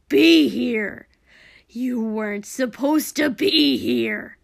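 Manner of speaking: loud, straining angry yell; forceful, labouring outburst; strained shout. Acted. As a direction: shout it with full effort. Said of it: "Dobby's voice was strained with effort".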